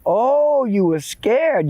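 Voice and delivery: high, drawn-out mock sympathy; sarcastic, exaggerated echo. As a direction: taunt him, stretching it out high. Old man mocks the boy's excuse.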